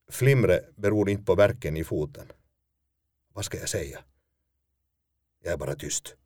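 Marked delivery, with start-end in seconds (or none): none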